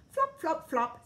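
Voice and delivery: high, loud flops; flopping sound. A narrator's reading. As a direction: slap out each flop.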